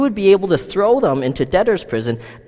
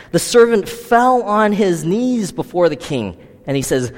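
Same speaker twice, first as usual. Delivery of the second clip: ordinary talk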